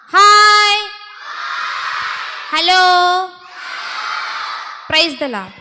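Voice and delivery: loud, high drawn-out greetings; rousing call-out to a group of children. Shown as a demonstration. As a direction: belt out each greeting for the children to echo. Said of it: "சத்தமா சொல்லுவோமா".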